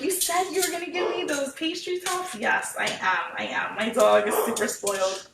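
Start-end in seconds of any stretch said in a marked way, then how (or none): 0.0-2.3 high, excited dog voice; voicing the dog. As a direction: speak as the eager family dog greeting Marina. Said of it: "The dog is barking 'cause he's like".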